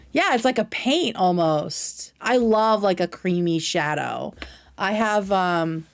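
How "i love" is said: normal talking voice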